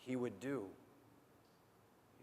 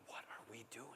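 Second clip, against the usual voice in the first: much softer, inward question; quiet musing; 4 semitones lower, 18 dB quieter than usual. Retold or imitated. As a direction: murmur it to yourself. Self-questioning thought.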